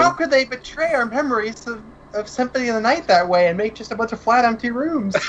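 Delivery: high, hammed-up betrayed wail; mock outrage. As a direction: play up fake fan betrayal. Mock outrage about game.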